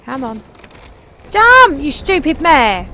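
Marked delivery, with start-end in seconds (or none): 1.3-2.9 furious yell, much louder, first word high; angry shout. Real, not acted. Rider furious at her mare refusing the jump, angry shout. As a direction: bark it at the horse in frustration.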